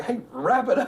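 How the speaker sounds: high-pitched hurry-up, played for laughs; mock impatient. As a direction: urge them off the phone in mock alarm. Mock impatience over phone minutes.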